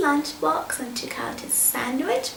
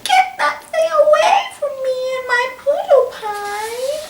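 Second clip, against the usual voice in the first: high, loud terrified shriek; 12 semitones higher, 9 dB louder than usual. A narrator's reading. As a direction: shriek at the snake in panic. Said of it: "Missus Poodleplum shrieked".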